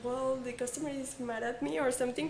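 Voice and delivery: normal talking voice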